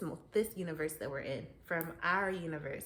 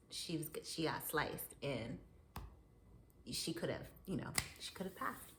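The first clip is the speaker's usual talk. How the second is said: unvoiced hushed aside; whispered aside; 4 semitones lower, 8 dB quieter than usual. Real, not acted. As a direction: drop to a whisper as if confiding. Aside about near death.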